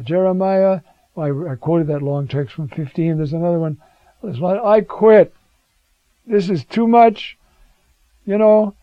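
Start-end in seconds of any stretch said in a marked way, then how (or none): 4.6-8.8 fed up. Acted. Jeremiah quitting, acted out.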